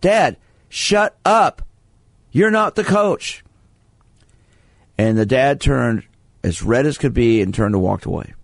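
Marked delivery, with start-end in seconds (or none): 0.0-3.4 high, slow yelled protest; mock a kid yelling up at his dad; retold yell. Son yelling up at dad from the field, high and slow. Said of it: "The son stopped and yelled up".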